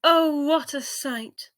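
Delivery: slow, high wonder; amazed. A narrator's reading. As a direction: marvel at the view. Narrator marvels.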